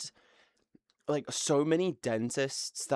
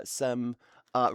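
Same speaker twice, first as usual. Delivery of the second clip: usual speaking voice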